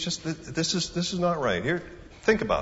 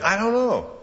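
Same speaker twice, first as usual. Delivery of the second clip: higher, puzzled "I don't know" tossed up; exasperated shrug; 10 semitones higher, 3 dB louder than usual. Shown as a demonstration. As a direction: throw up your hands on "I don't know". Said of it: "Why would he want a second opinion?".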